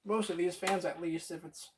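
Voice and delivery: talking around something in mouth; words muffled by a mouthful; mouth full. Real, not acted. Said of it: "I had something in my mouth".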